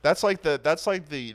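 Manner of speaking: laughing. Real, not acted. Still laughing at own answer.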